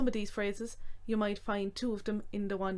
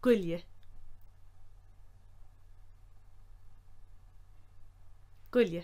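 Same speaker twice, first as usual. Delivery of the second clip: quick run-together Gulje; one fast word Gulje; said quickly; 4 semitones lower, 6 dB quieter than usual. Shown as a demonstration. Teacher repeating the fast form. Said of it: "Quickly said as".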